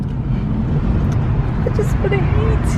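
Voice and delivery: high, slow, breathy whine; whiny whisper. Real, not acted. Whining while waiting for food.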